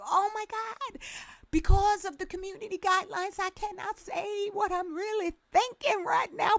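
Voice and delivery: high, soft mock whine of a cautious follower; mocking quote. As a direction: imitate them high and hushed, fast in the middle.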